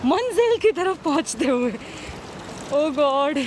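muffled behind cloth mask; speaking while walking masked; through a face mask. Real, not acted. Seen 3: Cloth mask covers mouth and nose throughout; reference frames unmasked.

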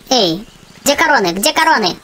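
ordinary delivery

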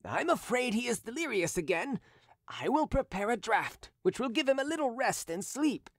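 a hint of a smooth schemer; lightly voiced Derues, falsely caring. A narrator's reading. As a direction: sound worried and helpful, hiding the poisoner. Derues feigning concern.

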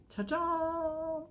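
high, sung-out ta-da; ta-da reveal. Real, not acted. Vlogger unveiling the first pin from the box, high sing-song ta-da. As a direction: present it like a magic trick.

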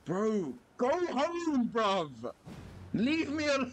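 very high-pitched wailing plea; real squealing gamer panic; exasperated panicked yell. Real, not acted. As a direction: scream at the pursuer to back off. Yelling at pursuer in game.

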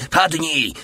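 high, sudden warning cry; hoarse yell. A narrator's reading. Soldier warns the squad to hit the ground, hoarse yell. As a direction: yell the command in one burst. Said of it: "wrzasnął ochryple Barcelona".

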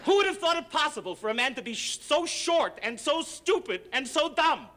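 comic rant. Acted. Harvey rants at Sonny.

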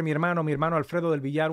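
ordinary delivery

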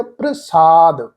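ਪ੍ਰਸਾਦ as "pra-saad", P and R blended; correct joined pronunciation. Shown as a demonstration. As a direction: blend the R straight onto P in ਪ੍ਰਸਾਦ. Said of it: "ਪਾਠ ਉਚਾਰਨ ਹੋਏਗਾ". (ਪ੍ਰਸਾਦ ਵਿੱਚ ਪੱਪਾ ਤੇ ਰਾਰਾ ਮਿਲਾ ਕੇ)

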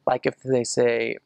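usual speaking voice

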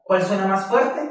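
ordinary talk, nothing marked

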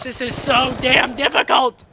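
real gamer frustration; frustrated shout. Real, not acted. Frustrated at difficulty.

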